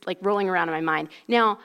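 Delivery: ordinary delivery, nothing marked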